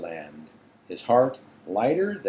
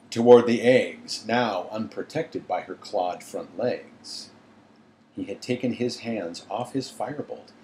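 ordinary delivery

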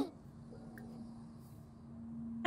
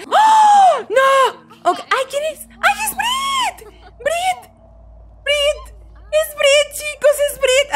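high, loud shouts of recognition; shouting her name in delight; excited shouting; 11 semitones higher, 4 dB louder, much slower than usual. Real, not acted. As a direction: call out thrilled at the friend. Friend Brit suddenly appears.

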